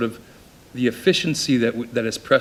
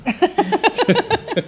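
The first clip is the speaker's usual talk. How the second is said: high nervous laugh; 4 dB louder than usual. Real, not acted. Laughs at reading own paper.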